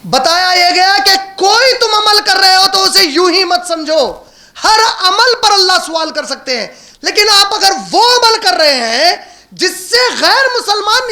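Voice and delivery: high, forceful, stretched-out phrases; impassioned preaching. Acted. Preacher warns listeners every deed will be questioned, impassioned. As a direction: drive each clause home to the congregation.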